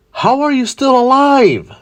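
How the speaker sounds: high-pitched, stunned outburst; incredulous villain. Acted. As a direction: exclaim it high, in disbelief. Villain shocked at surviving foe.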